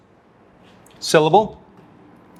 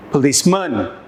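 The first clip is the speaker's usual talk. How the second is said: "Policeman" as puh-leece-MUN, last syllable stressed; wrongly stressed "Policeman", weight on the final syllable; stress on third syllable; 3 semitones lower than usual. Shown as a demonstration. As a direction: lean on the MAN at the end of "Policeman". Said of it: "try each option".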